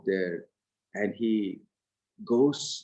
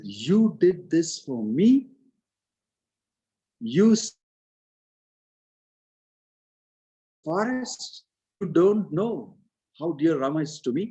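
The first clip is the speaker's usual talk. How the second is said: a son who can't believe what his mother did; shocked disbelief; 5 semitones higher, 3 dB louder than usual. Acted.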